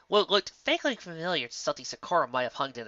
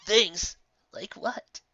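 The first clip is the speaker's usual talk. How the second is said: sniffly; 7 semitones higher, much slower than usual. Acted. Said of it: "He sniffled".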